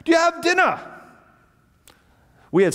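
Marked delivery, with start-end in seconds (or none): 0.1-0.8 put-on much higher, calling out as an old gentleman. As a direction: call the boys back from the doorway. Old host asks boys.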